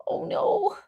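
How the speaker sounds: a whispered, mock-frightened oh no; hushed fake dread; mock scared whisper. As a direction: breathe it like a pretend scared child. Mocking fear of drones.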